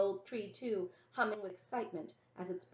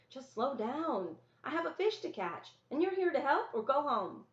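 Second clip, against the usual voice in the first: scolding; 6 semitones higher, 4 dB louder than usual. Acted. Sister scolds brother.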